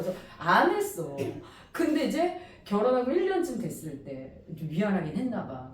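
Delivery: ordinary talk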